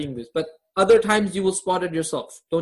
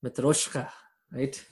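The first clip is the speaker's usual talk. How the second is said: low, softer attempt at a Khabib accent; lightly done Khabib-style accent; 5 semitones lower, 6 dB quieter than usual. Shown as a demonstration. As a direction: try your best Khabib accent on Matryoshka. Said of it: "in my best Khabib accent".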